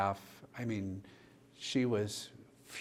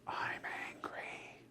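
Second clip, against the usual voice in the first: slow whispered confession; intense whisper; 10 dB quieter, slower than usual. Retold or imitated. The dying patient pulling him down by the tie, slow whisper. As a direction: hiss it close to his ear.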